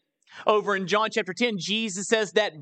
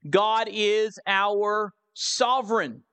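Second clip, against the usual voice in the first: measured announcement, pause before "sovereign"; slow, deliberate; slower than usual. Real, not acted. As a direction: spell out the heading slowly and weightily. Announces second point with weight.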